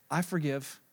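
low, soft acceptance; calm forgiveness. Acted. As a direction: let it go quietly. Calm answer to being wronged.

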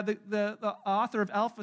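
usual speaking voice

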